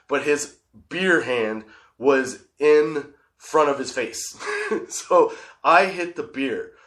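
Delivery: ordinary talk, nothing marked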